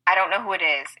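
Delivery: usual speaking voice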